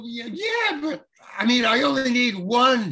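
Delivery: high-pitched, baffled objection, retold; incredulous protest. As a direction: protest in mock disbelief, pitched well up. Protesting the absurd pile of donuts.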